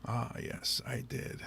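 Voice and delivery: normal talking voice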